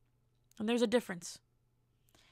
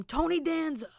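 higher-pitched mock yell; mock scream; 6 semitones higher than usual. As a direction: cry it out like a half-scream. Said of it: "and then scream".

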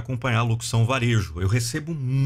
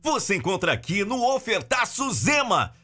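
high, punchy hard-sell retail announcer; retail ad read; 6 semitones higher than usual. Shown as a demonstration. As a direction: sell the deals like a TV store ad. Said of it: "Essa locução em específico é nesse estilo".

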